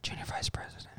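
barely audible whispered aside; hushed whisper. Pretend. Co-host spelling out the initials to friends, whispered. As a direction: slip it in under your breath, whispering. Sneaks in the censored name.

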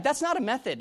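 high, loud retold objection; protesting. Preacher objecting in his head to her method, protesting. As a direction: act out exasperated protest.

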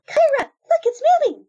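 high excited cry; thrilled, pointing it out. Acted. Egg starts moving.

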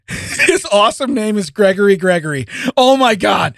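bursting out laughing, high and loud; cracking up at the name; excited laugh. Real, not acted. Reading a funny name aloud.

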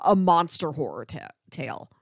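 normal talking voice

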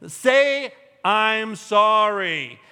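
loud, drawn-out order to apologize; mock parental command; stern parent demand. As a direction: spell it out slowly for a stubborn kid.

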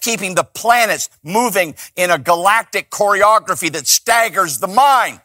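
usual speaking voice

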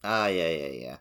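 ordinary talk

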